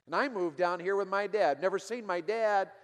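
loud, rising bitter retelling, slowing at the end; loud, bitter and desperate; bitter outburst. Retold or imitated. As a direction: let the resentment burst out. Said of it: "came out with such bitterness".

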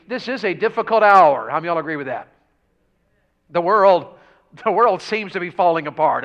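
loud, rising sermon cry; preaching at full force. Acted. Pastor stirring the congregation about the world, loud preaching. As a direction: press the point hard to the congregation.

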